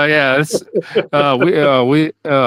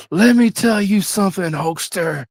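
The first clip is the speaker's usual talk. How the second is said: lightly voiced raspy wrestler promo; a hint of Hogan's promo growl; Hulk Hogan impression; 6 semitones higher than usual. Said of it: "He says".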